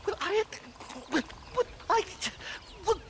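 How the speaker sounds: high, halting well I; flustered stammer. Acted. Professor cornered by angry natives, high nervous stammer. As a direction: stumble over the words, rattled.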